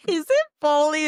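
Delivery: high, loud eager question; hopeful gasp of a guess; excited guess. Retold or imitated. Reader guessing the twist, high and eager.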